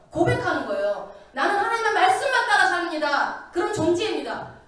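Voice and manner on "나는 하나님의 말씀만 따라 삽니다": loud, ringing proclamation of faith; proclaiming loudly to the congregation; bold declaration. Shown as a demonstration. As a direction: declare it like a vow, loud and sure. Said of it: "선포하는 거예요".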